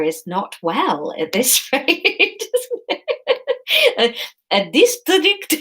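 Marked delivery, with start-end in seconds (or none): 4.0-5.5 high, hammed-up Spanish accent; attempted Spanish accent. Reader retrying the courier's line, high Spanish accent. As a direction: try an over-the-top Spanish accent. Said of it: "this accent comes with a hand gesture".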